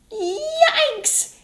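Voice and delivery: very high yelp of pain; startled yelp. Acted. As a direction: cry out as the tail burns. Wolf burns tail.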